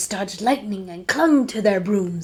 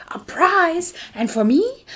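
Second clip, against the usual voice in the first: surprised grin; 9 semitones higher than usual. Acted. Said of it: "I replied with a grin".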